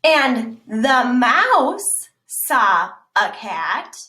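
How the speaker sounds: slow, loud stress on "fox"; slow storybook emphasis; slow emphatic reading. Shown as a demonstration. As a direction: draw out "fox" and land "saw a cat". Reading italic lines slowly.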